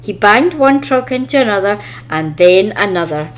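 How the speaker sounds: plain reading voice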